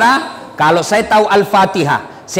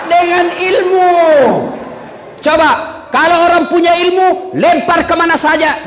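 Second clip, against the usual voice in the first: rising preacher shout; 8 semitones higher than usual. Real, not acted. Preacher raising voice on knowledge.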